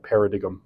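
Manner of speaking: "paradigm" as para-dig-um; mispronounced paradigm. Retold or imitated. Lecturer puzzled by the AI's error, mispronounced "paradigm". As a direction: say "paradigm" wrongly, with a hard g before um. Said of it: "there's no American or British pronunciation".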